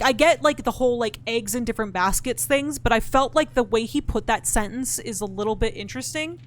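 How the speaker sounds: usual speaking voice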